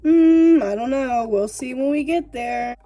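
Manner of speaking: loud hmm, then stalling parent; evasive parent. Retold or imitated. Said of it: "Like your parent ... they're like".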